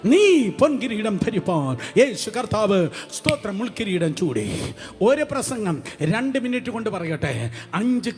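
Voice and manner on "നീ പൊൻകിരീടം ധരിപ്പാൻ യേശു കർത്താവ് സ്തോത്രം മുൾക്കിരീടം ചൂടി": louder, emphatic pulpit proclamation; preacher driving the point home; full-force preaching. Acted. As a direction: proclaim the crown of thorns with force.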